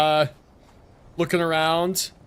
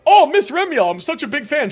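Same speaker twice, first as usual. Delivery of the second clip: starstruck fan; 11 semitones higher, 6 dB louder than usual. Pretend. Meeting a famous character.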